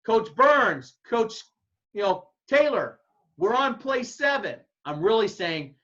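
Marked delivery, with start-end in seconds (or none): 0.0-4.6 loud, higher call to chatting coaches; head coach calling out to chatting assistants. Retold or imitated. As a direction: shout it over to the two talkers. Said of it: "if you two are talking and I say".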